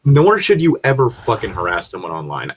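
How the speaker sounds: ordinary delivery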